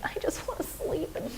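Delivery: put-on a worn-out child begging her dad for sleep, whining; whiny tired kid. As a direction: sigh it out like an exhausted child. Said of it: "And you're like".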